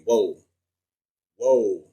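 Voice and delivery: ordinary delivery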